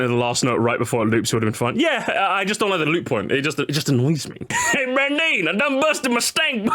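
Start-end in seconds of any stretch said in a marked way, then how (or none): 4.7-6.7 higher, laughing mock Southern read; Southern accent. Reading a comment aloud to chat, laughing mock Southern accent. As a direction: read it like a hillbilly, cracking up. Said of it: "the most southern sentence I've ever read".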